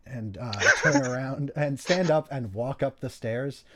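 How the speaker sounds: normal talking voice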